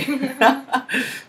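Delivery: short, high-pitched chuckle; amused laugh. Real, not acted. Amused reading a viewer's greeting.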